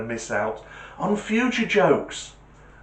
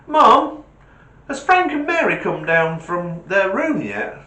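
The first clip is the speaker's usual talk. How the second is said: put-on kid calling mum; 8 semitones higher, 6 dB louder than usual.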